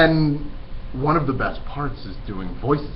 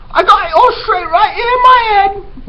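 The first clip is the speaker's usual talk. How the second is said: put-on high, loud, brash girl voice; eager Mig; 10 dB louder than usual. Teacher voicing dim servant girl Mig, high and loud. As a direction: pitch way up and blurt it out loud.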